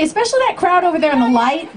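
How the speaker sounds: ordinary delivery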